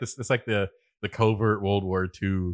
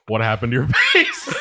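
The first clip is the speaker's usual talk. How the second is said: mock shout; 7 dB louder than usual. Acted henchman line in Bond parody.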